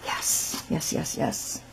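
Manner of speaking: soft delighted whisper; breathy pleased yeses. Real, not acted. Pleased murmur over lace.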